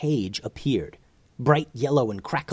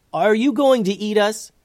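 high, loud nervous question; 11 semitones higher, 6 dB louder than usual. Acted. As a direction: ask it straight out, scared. Asking dragon nervously.

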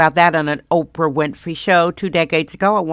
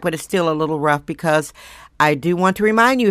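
ordinary talk, nothing marked

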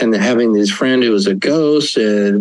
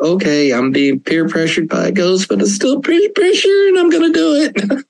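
mock teen voice; 7 semitones higher than usual. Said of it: "it's like".

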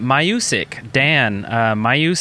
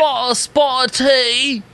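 high posh British voice; hammed-up posh Brit; British accent; 10 semitones higher than usual. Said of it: "very horrible at British accents".

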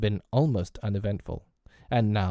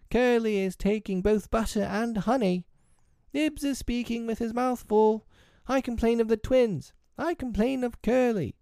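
put-on very high-pitched tattling children; squabbling little tattletales in high voices; children telling tales; 13 semitones higher than usual. A narrator's reading. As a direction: pipe each complaint up high, one after another. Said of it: "The hateful telling broke out again".

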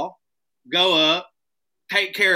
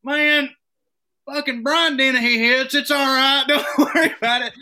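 higher, louder, laughing reassurance; mock reassuring friend; 9 semitones higher, 6 dB louder than usual. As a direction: brush it off like a buddy. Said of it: "he goes".

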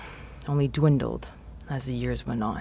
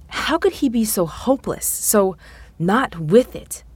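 ordinary delivery

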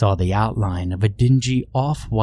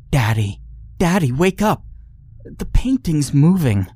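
put-on scared little girl; 7 semitones higher than usual. Frightened girl wakes her father.

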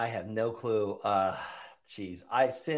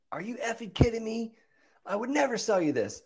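squeaky-high incredulous protest, played for fun; indignant caller; 12 semitones higher than usual. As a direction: imitate an offended seller in a high voice. Voicing angry callers to his letters.

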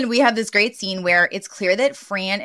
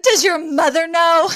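high-pitched scandalized question; indignant; 10 semitones higher, 3 dB louder than usual. Retold or imitated. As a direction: imitate outraged disbelief, pitched way up. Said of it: "She is so indignant".

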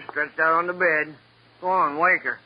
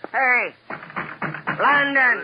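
loud, raised call through a closed door; 8 semitones higher, much slower than usual. Acted. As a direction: bellow her name to wake her up. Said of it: "Here, I'll show you".

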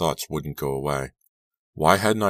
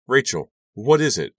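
much higher, louder alarmed cry; cried out; 9 semitones higher, 7 dB louder than usual. A narrator's reading. Girl on the phone cries to her friend, much louder. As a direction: call out in alarm. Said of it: "I cried".

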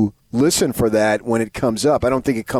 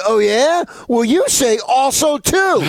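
wife's retort; 10 semitones higher, slower than usual. Retold or imitated. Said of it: "she says".

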